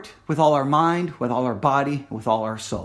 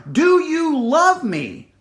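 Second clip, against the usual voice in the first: pleading question; 8 semitones higher, 4 dB louder, much slower than usual. Acted. Jesus asks second time.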